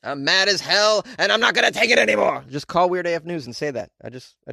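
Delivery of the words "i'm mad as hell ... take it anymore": louder and higher, yelling out an open window; hammed-up furious tirade at the whole street; a playful cry of outrage; mock rant. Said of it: "open the window and be like".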